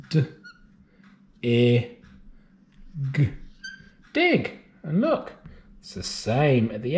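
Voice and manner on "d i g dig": segment D, I, g then Dig; sounding out words. Shown as a demonstration. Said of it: "Let's write it".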